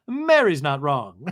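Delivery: slow, loud, drawn-out pronouncement; castmate impression. Castmate siding in a quarrel, slow hammed-up declaration. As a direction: draw it out loudly as a hammed-up verdict.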